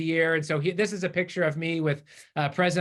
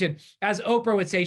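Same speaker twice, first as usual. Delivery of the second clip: ordinary delivery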